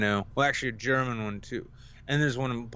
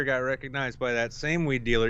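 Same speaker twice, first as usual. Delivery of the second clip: ordinary talk, nothing marked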